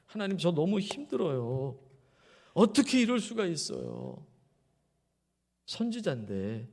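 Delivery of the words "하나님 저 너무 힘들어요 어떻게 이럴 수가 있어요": higher, pleading complaint to God; angry plea. Acted. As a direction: protest to God, frustrated and hurt. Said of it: "성난 마음 하나님 앞에 호소하는 거예요".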